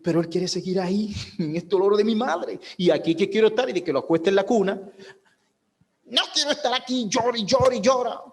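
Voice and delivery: put-on high, pleading baby voice; voicing a whiny baby. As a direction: play the fussy infant begging to stay held. Preacher voices crying baby's wants.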